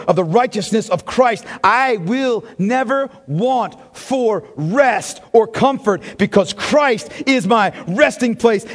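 fervent preaching of the psalm; psalm declaration. Acted. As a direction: testify it line by line. Quoting the psalm as his own.

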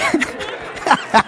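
breaking into a laugh; real laugh. Real, not acted. Preacher kidding the congregation about his looks, laughing. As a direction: laugh at your own joke.

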